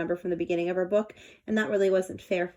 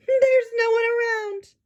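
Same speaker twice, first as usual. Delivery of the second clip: higher, louder puzzled elephant voice; Horton puzzled; 6 dB louder than usual. Acted. Horton looks around the jungle, higher puzzled voice. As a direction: call it out, wondering. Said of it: "thought Horton".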